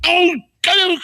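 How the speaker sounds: high, mock-exasperated groan of a frustrated pundit; mock exasperated Roland. Talking about an always-mad pundit, mock exasperated groan. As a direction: squeeze out a high, exasperated oh God. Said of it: "He was like this".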